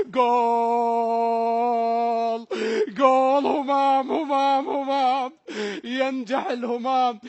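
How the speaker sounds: long drawn-out goal cry; goal shout. Real, not acted. Commentator hailing the scorer, drawn-out joyful call. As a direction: stretch the goal call out in joy.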